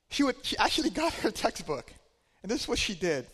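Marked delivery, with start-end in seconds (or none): none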